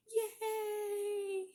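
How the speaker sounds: high, long squealed yay; gleeful cheer. Real, not acted. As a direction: celebrate with a drawn-out high squeal. Cheering at the scene.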